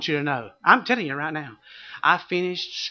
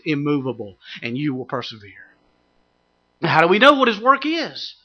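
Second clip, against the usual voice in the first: ordinary delivery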